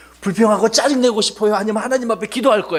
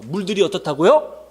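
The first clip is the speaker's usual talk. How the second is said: normal talking voice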